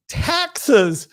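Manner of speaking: put-on high, whiny child's wail, stretched out; crying little boy. Little boy crying to his mother over the game, high whiny wail. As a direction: whimper it like a little kid who just lost at Monopoly. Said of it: "he's crying".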